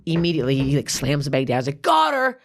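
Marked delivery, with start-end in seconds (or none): 1.8-2.4 high mock announcement; triumphant call. Guard slamming bag down in store, high triumphant call. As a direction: call it out as the proud accuser.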